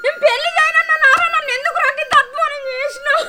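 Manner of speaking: wailing lament. Acted. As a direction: cry out the grievance at him. Mother wails at son.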